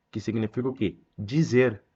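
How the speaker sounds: ordinary talk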